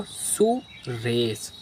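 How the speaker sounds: teacher reading a name to pupils; slow reading. Shown as a demonstration. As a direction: draw the name out slowly. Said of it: "क्या पढ़ेंगे इसको?".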